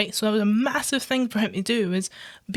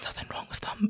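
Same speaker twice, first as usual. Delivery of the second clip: hushed aside; whispering; 10 dB quieter than usual. Real, not acted. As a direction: drop to a whisper. Aside during rant.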